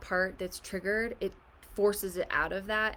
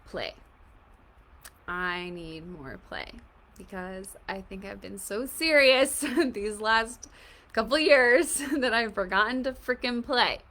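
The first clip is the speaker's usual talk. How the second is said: laughing; 3 semitones higher, 10 dB louder, slower than usual. Retold or imitated. Her playful part answering.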